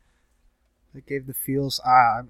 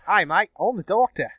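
higher-pitched, hammed-up Australian greeting; mock Australian accent; 6 semitones higher than usual. Would-be Doctor greeting someone, mock Australian accent. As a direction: play a hammy Australian Doctor. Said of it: "I'd be Australian".

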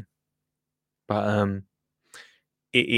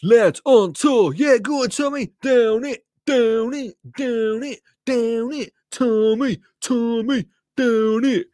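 rowdy jeering pub banter; 7 semitones higher, slower than usual. Pretend. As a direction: egg your mate on to down it. Mates egging Tommy to drink.

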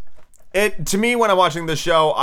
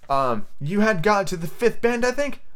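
high, tentative, slower answer; humble; 8 semitones higher, slower than usual. A narrator's reading. Alice guesses where the mouse had got to, humble. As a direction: offer it meekly, rising into a question. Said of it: "said Alice very humbly".

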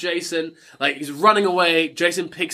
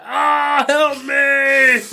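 higher and louder, hammed-up plea for help; mock cry for help; 9 semitones higher, 5 dB louder, much slower than usual. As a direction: call out like you're wounded outside. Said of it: "hear [name] being like".

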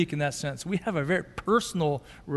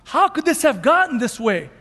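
angry dismay; 7 semitones higher, 3 dB louder than usual. Retold or imitated. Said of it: "He's like".

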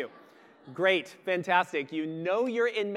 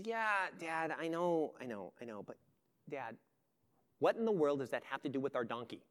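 eye-rolling son; 3 semitones lower, 5 dB quieter than usual. Acted. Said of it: "slightly rolls his eyes".

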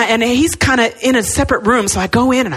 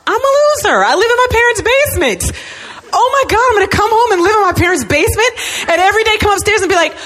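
high-pitched hammed-up meltdown; comic high wail of despair; mock despairing wail; 9 semitones higher than usual. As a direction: wail it in mock self-pity, high and whiny. Acting out her own despairing meltdown.

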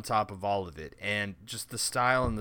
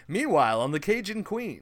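louder, higher TV narrator parody; over-enthusiastic educational voiceover; 7 semitones higher, 5 dB louder than usual. Retold or imitated. Co-host imitating a fake reality show, over-enthusiastic voiceover. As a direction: narrate like an overeager documentary host. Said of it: "over-enthusiastic educational voiceover".

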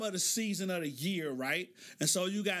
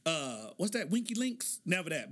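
ordinary talk, nothing marked

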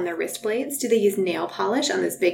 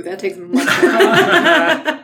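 slow, loud words broken by real laughter; drawn out through a laugh; 6 semitones higher, 10 dB louder, much slower than usual. Real, not acted. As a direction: laugh your way through the line.

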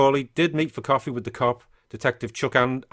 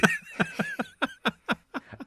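a burst of high-pitched laughter; high squealing laugh; 3 dB quieter than usual. Real, not acted. Laughing at his own failed accent impression. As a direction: crack up at yourself.

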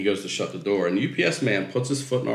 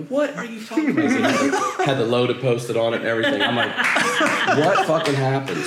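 higher, laughing question; laughing disbelief; 8 semitones higher, 3 dB louder than usual. Retold or imitated. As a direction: ask in amused shock.

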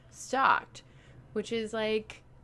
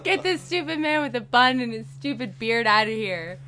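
higher, louder grumpy outburst; mock indignation; 5 semitones higher, 7 dB louder than usual. Old man griping about a bearded hipster, mock indignant. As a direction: play at being fed up.

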